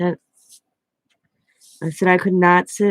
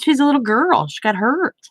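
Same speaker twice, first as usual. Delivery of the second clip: higher-pitched, swooping pleading protest; retold protest; 6 semitones higher than usual. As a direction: make the case for the child, pitch swinging up.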